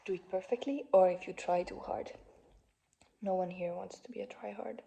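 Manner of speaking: ordinary delivery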